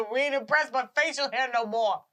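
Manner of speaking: hammed-up scornful holler; jeering heckler. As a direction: heckle the act from the crowd. Imitating unimpressed sideshow crowd.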